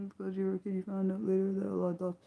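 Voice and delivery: ordinary delivery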